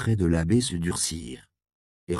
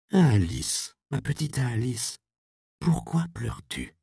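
tender mother; 5 semitones higher than usual. Acted.

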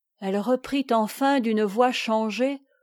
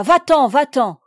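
loud, raised cry; shouted dismissal; 4 semitones higher, 8 dB louder than usual. A narrator's reading. Jeanne who can bear no more, shouting at her maid. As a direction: cry out to drive her away. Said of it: "Elle cria".

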